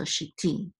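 "shiti" stressed on the final "ti"; "shiti" accented on its last syllable. Shown as a demonstration. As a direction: lean on the end of "shiti". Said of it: "accent for the last syllable".

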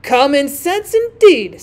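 indignant. Acted. Rocket retorts to the Bengal light, indignant. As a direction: throw it back, rising in indignation. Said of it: "said the rocket indignantly".

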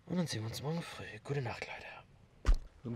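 soft, slow whisper. Real, not acted. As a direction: whisper goodnight slowly. Saying goodnight at night. (leise, langsam geflüstert)

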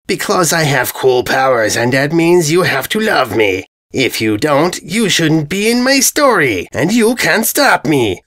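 lightly voiced dopey brute; a spoiled villain setting the rules; dim bully invader. Invader again.

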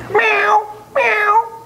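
high toy kitty meow; cat meow. Pretend. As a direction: mew like a little cat.

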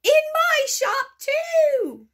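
over-the-top delighted yell. Acted. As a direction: bellow it with glee. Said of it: "he yelled".

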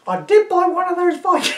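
put-on high shrieking old woman; an indignant old dear shooing a Viking; old lady to constable, high and indignant; crone voice. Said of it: "my crone voice".

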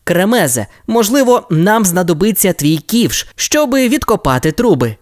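put-on brief the team like a young captain; lightly voiced Ryder. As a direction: Ryder gives the pups their mission. Ryder giving orders.

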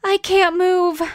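high, loud cry of distress; panicked gasp. Acted. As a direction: cry out, helpless and hurt. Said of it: "Quixotic breathed".

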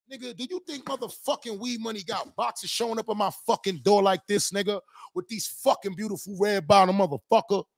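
heated, high-pitched tirade; ranting at a fan about his money; angry rant. Real, not acted. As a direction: keep railing at him, offended. Ranting at a critic over weed money.

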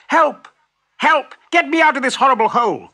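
high, loud, urgent yell; yelling for help. A narrator's reading. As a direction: yell out as if stuck and desperate. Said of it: "and yelled".

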